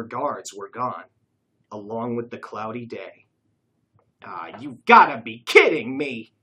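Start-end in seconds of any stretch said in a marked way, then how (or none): 4.2-6.3 exasperated outburst. A narrator's reading. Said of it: "sighed Dave".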